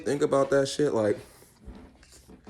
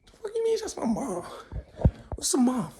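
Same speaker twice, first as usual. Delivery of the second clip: put-on high, softer tearful baby voice; bewildered newborn; 10 semitones higher, 6 dB quieter than usual. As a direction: whimper like a confused mock baby. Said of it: "soon as I was born".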